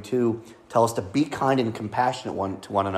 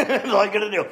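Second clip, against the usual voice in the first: laughing; 10 semitones higher than usual. Real, not acted. Laughing at the sour candy.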